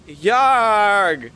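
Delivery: loud gruff pirate cry; pirate yarg. Acted. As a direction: bellow it like a pirate captain. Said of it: "let me hear your best yarg".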